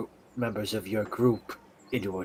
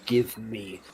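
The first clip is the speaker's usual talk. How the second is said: slow menacing demand; much slower than usual. Acted. Snips demanding the truth from Riolus, slow and menacing. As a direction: drag each word out to scare him.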